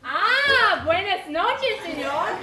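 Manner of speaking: put-on theatrical Spanish-accented greeting. New guest greeting the gentleman, in a Spanish accent. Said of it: "Not another accent".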